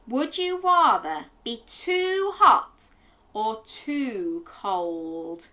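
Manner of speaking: put-on slow, deliberate question to children; drawn-out, playful quiz voice; slow storyteller. Slow game-host question.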